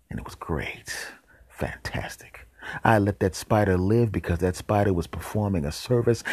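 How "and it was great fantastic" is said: slow, hushed satisfaction; gleeful whisper. Acted. Savoring the fly's end, whispered. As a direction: whisper it with relish.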